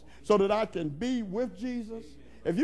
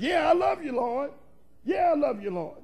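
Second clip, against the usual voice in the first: higher-pitched, irritated insistence; exasperated; 5 semitones higher than usual. Acted. Peter answers the Lord's repeated question, exasperated. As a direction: answer as if fed up with being asked. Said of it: "you're about to get on my nerves".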